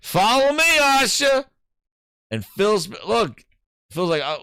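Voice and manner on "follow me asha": higher, louder call to Asha. Retold or imitated. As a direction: call her over, rising to a shout. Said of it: "He's like".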